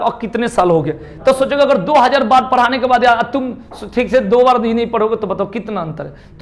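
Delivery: ordinary talk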